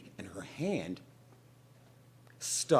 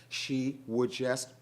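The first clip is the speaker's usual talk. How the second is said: ordinary delivery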